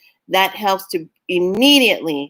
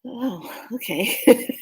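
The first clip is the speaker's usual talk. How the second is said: mock resigned; 4 semitones higher, 4 dB quieter, much slower than usual. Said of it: "you're like".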